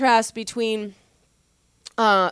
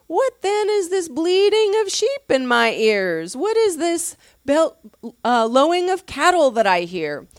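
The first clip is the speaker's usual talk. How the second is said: higher, louder, dripping mock disbelief; sarcastic; 7 semitones higher, 4 dB louder than usual. Samuel mocking Saul's false claim, sarcastic and raised. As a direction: needle him with pointed sarcasm. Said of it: "this is sarcasm".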